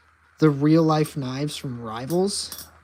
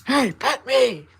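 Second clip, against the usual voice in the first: higher, pushy mock cat voice; demanding cat; 6 semitones higher, 6 dB louder, slower than usual. Cat demanding to be petted, pushy mock voice. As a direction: blurt it as an attention-seeking cat, higher and louder. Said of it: "she comes over like".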